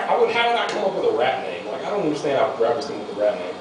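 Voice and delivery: ordinary talk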